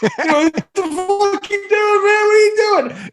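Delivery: very high, slow, panicked squeal; pinned under the bar and terrified; panicked friend. Retold or imitated. Jimmy pinned under the bench press, squealing in panic.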